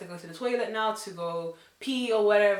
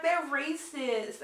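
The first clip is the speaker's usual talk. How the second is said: mocking whine; 8 semitones higher, much slower than usual.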